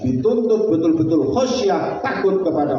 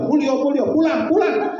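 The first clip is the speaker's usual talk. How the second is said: much higher, hammed-up shoo; shooing him out; 11 semitones higher than usual. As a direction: shoo the man out in a high mock voice. Woman chasing the man out.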